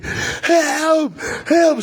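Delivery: high desperate cries of help; retold scream for help. Fleeing out the back door, screaming high for help. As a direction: shout for rescue at the top of the voice. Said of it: "I'm gonna go out there screaming high heaven".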